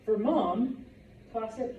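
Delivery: ordinary talk, nothing marked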